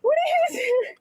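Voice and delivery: crying and pleading; sobbing. Real, not acted. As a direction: speak through real sobs. Cuffed, sobbing.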